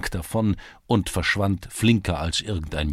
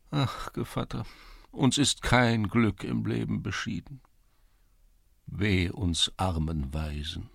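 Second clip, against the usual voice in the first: self-pitying lament; 7 semitones higher than usual. A narrator's reading. Czerewik laments misfortune.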